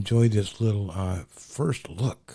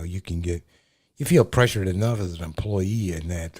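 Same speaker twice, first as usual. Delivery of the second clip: ordinary talk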